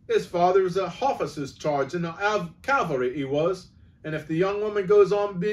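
cabby complaining to the policeman; aggrieved cockney cabby. Acted. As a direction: stake your claim loudly. Cabby insists.

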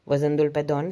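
plain reading voice

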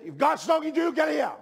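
high, loud altar call; angry shout. Retold or imitated. Preacher re-enacting his angry altar call to the conference, shouted. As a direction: shout the summons in exasperation. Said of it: "they all shouted".

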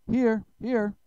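high, loud little character; roll-call answer. A narrator's reading. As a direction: call out to be counted.

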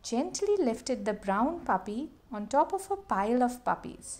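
ordinary delivery, nothing marked

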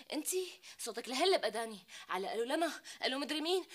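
normal talking voice

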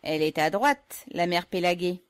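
loud, teasing compliment; joking. Acted. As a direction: josh her good-naturedly. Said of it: "en plaisantant".

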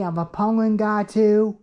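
ordinary delivery

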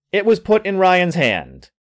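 ordinary delivery

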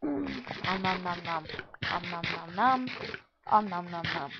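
hammed-up om nom nom chomping; greedy smacking while eating. Eating sound effect.

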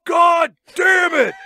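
loud, drawn-out mock scream; over-the-top imitated movie scream. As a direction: bellow it high and slow, hammed-up. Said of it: "he screams".

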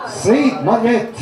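usual speaking voice

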